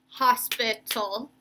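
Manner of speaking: "hospital" slow and segmented; three syllables. Shown as a demonstration. Teacher counting syllables for pupils, "hospital" slowly in three parts. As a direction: sound out "hospital" in three beats. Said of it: "We know that's three".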